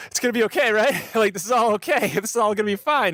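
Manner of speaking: acted panicky breaths, high pitch; gasp, then reassure yourself in a high panicky voice; hyperventilating. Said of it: "I'm starting to hyperventilate and going".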